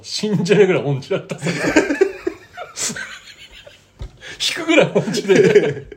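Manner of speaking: words broken by real laughter; laughing hard while telling it. Real, not acted. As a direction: laugh through the punchline. Laughing at the tone-deaf memory.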